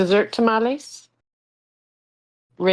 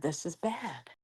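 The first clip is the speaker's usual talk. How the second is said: much softer, under the breath; muttered; 2 semitones lower, 13 dB quieter than usual. Shown as a demonstration. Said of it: "Or this is bad".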